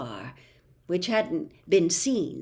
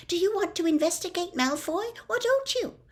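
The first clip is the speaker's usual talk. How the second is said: rising higher and faster into a demanding question; stern challenge; 12 semitones higher than usual. A narrator's reading. Hermione challenging Harry and Ron to commit, stern and insistent. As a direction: dare them to back out. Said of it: "she had sternly".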